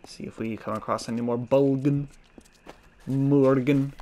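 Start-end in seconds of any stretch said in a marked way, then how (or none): 3.1-3.9 MORGAN drawn out, savouring the first syllable; imitating gnome's pronunciation. Player echoing a gnome's word he loves, imitated pronunciation of Morgan. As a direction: say Morgan long and rounded, like the gnome. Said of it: "the way that that gnome pronounced the word Morgan".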